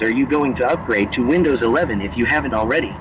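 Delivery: normal talking voice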